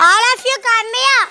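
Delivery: high call to come here. Acted. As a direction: call them over, high and slow. Calling kids to come.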